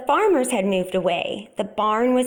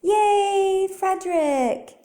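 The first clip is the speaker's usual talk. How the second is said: cheering; 10 semitones higher, much slower than usual. A narrator's reading. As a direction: cheer him on with joy. Mice cheering after the poem.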